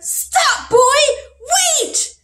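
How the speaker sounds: high, loud shout from far off; calling out. Acted. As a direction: call out to stop him. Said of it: "a voice from the darkness called out".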